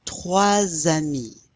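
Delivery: slow, careful Trois amis with linked z; model pronunciation. Shown as a demonstration. Teacher slowly models the linking for students to repeat. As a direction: Trois amis spoken slowly, z sound joining the two words.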